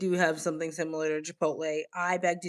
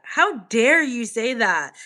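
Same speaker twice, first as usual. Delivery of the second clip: slower, louder offended repeat; mock outrage; 4 semitones higher, 6 dB louder, slower than usual. Answering a viewer's comment, mock outraged and louder. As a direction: play up the outrage, slowly. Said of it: "mock offense at comment".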